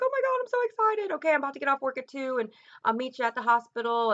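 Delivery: high, fast, thrilled sister's voice; imitating excited sister. Sister on the phone from work, hearing the news, excited high squeal. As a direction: squeal it out, bubbling over. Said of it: "she's crying on the phone at work".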